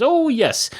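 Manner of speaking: squeaky high "oh yes". Acted. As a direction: jump way up on "oh". Character's eager reply.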